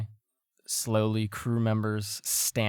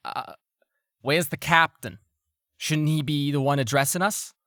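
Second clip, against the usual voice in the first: hesitant nervous crewman, higher; stammering; 5 semitones higher, 4 dB louder than usual. Acted. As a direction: stammer out the question. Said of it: "stammer and say".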